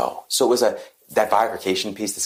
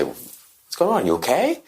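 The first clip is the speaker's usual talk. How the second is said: mock concern; 11 semitones higher, slower than usual. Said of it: "They would say".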